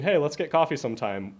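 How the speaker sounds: usual speaking voice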